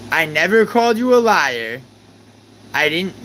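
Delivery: high, loud insistent denial; yelled denial. Real, not acted. Streamer yelling at Lane that he never called her a liar. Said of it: "just 'cause I'm yelling".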